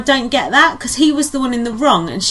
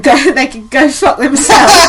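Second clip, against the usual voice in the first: laughing quote; 3 semitones higher, 7 dB louder than usual. Retold or imitated. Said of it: "And Gary went".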